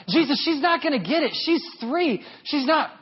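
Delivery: high, pushy protest to Jesus; pleading protest. Retold or imitated.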